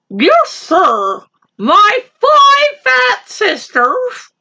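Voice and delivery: very high, slow rabbit voice. Acted. Rabbit tempting the lion, very high and slow. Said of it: "the little rabbit said".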